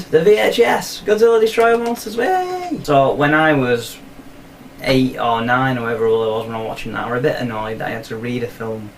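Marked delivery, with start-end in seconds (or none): 0.0-2.8 high, quickening cheer for the title; mock cheer. Host hailing his old VHS tape, mock cheering. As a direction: sing out the title higher and higher into a cheer.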